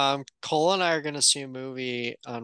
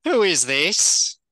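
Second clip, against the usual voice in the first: loud, drawn-out demand; put-on indignant phone voice; suspicious Billy; 5 semitones higher, 8 dB louder, slower than usual. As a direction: answer the phone warily, slow and loud. Acts Billy answering pretend call.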